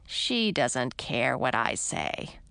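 low, slow shrug; dismissive. A narrator's reading. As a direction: brush it off.

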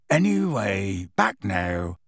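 low, trailing rustic voice; feeble. A narrator's reading. Gamekeeper covering an awkward slip, feebly. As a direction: feebly let the subject drop. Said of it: "he said feebly".

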